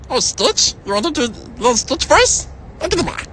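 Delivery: high, strange alien cartoon creature voice; squeaky little alien, up high; Stitch voice. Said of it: "Do Stitch".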